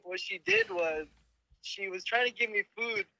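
usual speaking voice

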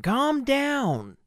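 ordinary delivery

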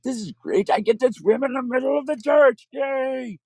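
put-on high, gleeful kid voice; childlike glee. Boy about to be baptized, high and gleeful. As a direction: squeal it like an excited child.

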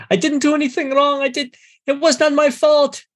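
high, pleading, tearful cry; crying panicked priest. Acted. Dragged-in priest protesting his innocence, high tearful wail. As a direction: wail it like a terrified man being dragged.

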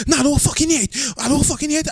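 hammed-up angry retort; angry coworker. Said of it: "angry coworker voiced in the story".

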